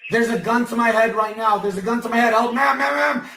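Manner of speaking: high, loud frantic screams for help; panicked screaming. Acted. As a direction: scream as if a gun is at your head. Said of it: "That's what I have to do to get help?".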